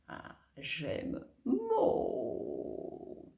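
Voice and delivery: very slow, whispered drawl; stretched-out sounds. Acted. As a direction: stretch it like chewing gum.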